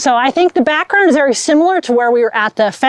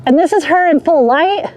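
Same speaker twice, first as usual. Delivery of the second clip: usual speaking voice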